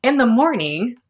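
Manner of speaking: usual speaking voice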